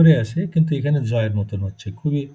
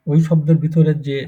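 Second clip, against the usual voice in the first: usual speaking voice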